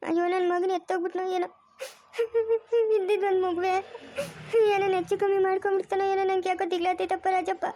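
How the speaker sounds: mother wailing in fear for her son; tearful worry. Acted. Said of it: "ಯಾಕೆ ಅಳ್ತೀರಾ?". (ಅಳುತ್ತಾ ಆತಂಕದಿಂದ)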